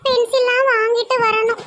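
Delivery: high-pitched mother figure; fretting village mother. Acted. Mother lists school things to buy, fretting in high voice. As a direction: talk in a high, put-upon voice.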